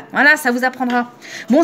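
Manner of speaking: usual speaking voice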